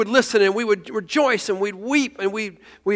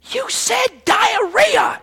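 high, slow, stretched shout; wife's shocked shout across the pew; 13 semitones higher, much slower than usual. Retold or imitated. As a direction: blurt it out in horror, drawn out. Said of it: "she went".

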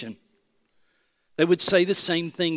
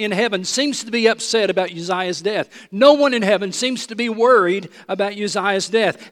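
ordinary delivery

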